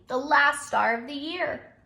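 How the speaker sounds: grumpy bear. Acted. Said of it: "replied Bear grumpily".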